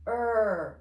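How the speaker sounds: normal talking voice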